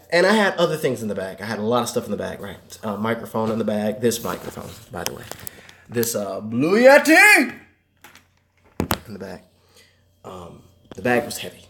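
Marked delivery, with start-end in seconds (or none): 6.4-7.6 high slow product announcement; announcer voice. Pretend. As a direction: present it like a showpiece. Announcing mic name.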